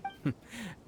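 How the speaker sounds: short rising pleased laugh; a quick amused chuckle; light laugh. Acted. Pleased at the vendor's answer.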